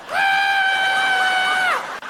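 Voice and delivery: very high sustained shriek; mock group scream. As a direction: hold a piercing comic scream. Imitates whole crowd screaming at snake.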